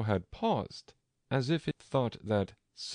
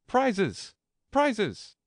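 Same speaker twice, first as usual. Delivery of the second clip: high, loud confused clamour; calling out; 13 semitones higher, 6 dB louder than usual. Acted. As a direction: shout it like an excited crowd. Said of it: "calling out in a confused way".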